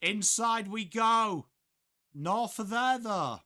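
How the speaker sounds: high, loud, drawn-out footy call; live play-by-play. Real, not acted. Commentator calls the ball going inside, high loud play-by-play. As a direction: stretch it out over the action.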